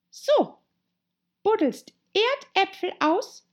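high, slow, indignant voice; annoyed Rabbit. A narrator's reading. Rabbit cross with Pat for digging, high and irritated. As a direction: repeat it high and scornfully drawn out. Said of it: "sagte das Kaninchen ärgerlich".

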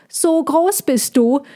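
high, drawn-out squirrel cry; calling out. A narrator's reading. Squirrel carrying on at the old dragon, calling out. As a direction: pipe it up high and slow. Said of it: "rief das Eichhörnchen weiter".

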